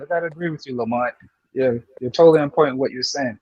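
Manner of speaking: ordinary talk, nothing marked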